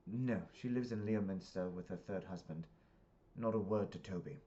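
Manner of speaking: put-on UK-accented dialogue; British accent. Parent confiding in daughter, British accent. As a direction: answer in a British voice. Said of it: "I speak in the accents 'cause it's U K".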